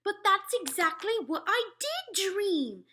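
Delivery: high, loud, slow exclamation; cried out. A narrator's reading. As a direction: burst out in amazement. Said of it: "the Queen cried out".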